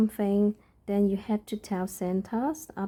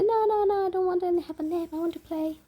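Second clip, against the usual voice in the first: put-on whiny child; 11 semitones higher, faster than usual. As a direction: play the little girl refusing a nap. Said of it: "And she'll say".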